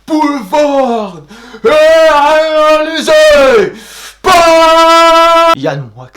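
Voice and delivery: slow, booming, rising announcer. Acted. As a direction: hype it like a showman. Hyped cast announcement.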